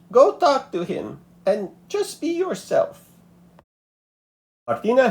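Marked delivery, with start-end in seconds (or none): 0.1-3.1 wise grandmother. A narrator's reading. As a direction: say it knowingly, like a wise old abuela.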